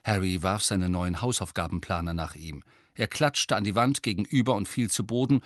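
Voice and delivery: usual speaking voice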